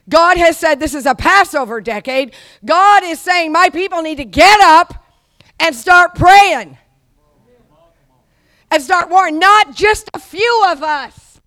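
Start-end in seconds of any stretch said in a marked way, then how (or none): 2.6-6.8 loud, higher, slow sermon cry; preaching at full force. Acted. 8.7-11.4 loud, higher, slow sermon cry; preaching at full force. Acted.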